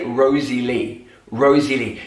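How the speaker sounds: "Rosie Lee" spelled out slowly, every syllable clear; "Rosie Lee" drawn out at a slow pace; slow teaching demo. Shown as a demonstration. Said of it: "you might hear someone say".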